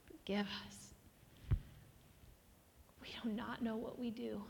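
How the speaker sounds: very soft, whispered plea; whispered confession. Real, not acted. Pastor asking God's forgiveness for the people, whispered plea. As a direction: pray it under your breath.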